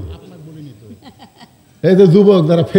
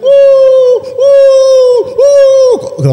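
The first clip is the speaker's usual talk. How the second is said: high, long-held "ooo" howl; imitated dog howl; 4 dB louder, much slower than usual. Muezzin mimicking dogs howling at the mosque door, howling. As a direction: howl like dogs, three drawn-out calls. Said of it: "ওই কুত্তের উ শব্দ".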